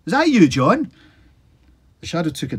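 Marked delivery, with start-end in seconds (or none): 0.1-0.9 loud, much higher question; calling out. A narrator's reading. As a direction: shout into the fog. Calling into the fog.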